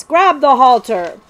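bossy call to a dawdling servant; impatient command. A narrator's reading. Prince orders his servant to take the horse, impatient command.

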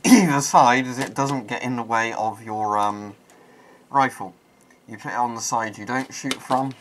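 muffled behind mask filter; through a gas mask. Real, not acted. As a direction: voice inside sealed respirator. Seen 4: Full-face gas mask worn throughout; reference frames show bare face.

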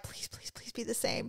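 hushed, pleading whisper; whispered prayer over the box; desperate whisper. Retold or imitated. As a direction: beg under your breath. Pleading while opening the package.

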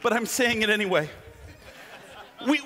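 ordinary talk, nothing marked

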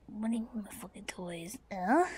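very low, hushed grumble; whispered indignant aside; muttered aside. Acted. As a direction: mutter indignantly under your breath.

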